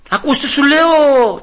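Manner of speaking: high, slow mock menace from a caller; hammed-up phone threat; mock threatening caller. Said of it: "και να φωνάζει στο τηλέφωνο".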